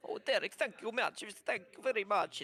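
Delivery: lightly voiced kobold with a hint of an Italian accent; eager little trader, thankful and fussy; grateful kobold merchant. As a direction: kobold in a put-on Italian lilt.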